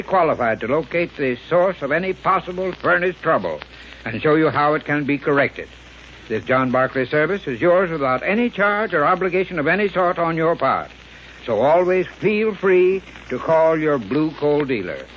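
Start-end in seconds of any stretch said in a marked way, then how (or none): none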